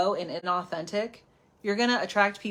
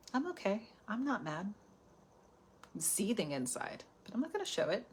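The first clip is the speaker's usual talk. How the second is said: softer, hint of fake calm; mock fake calm; 9 dB quieter than usual. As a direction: say you're fine through your teeth. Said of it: "you pretend everything is okay".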